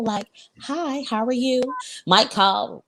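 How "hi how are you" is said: polite work phone voice. Retold or imitated. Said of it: "When I answer the phone".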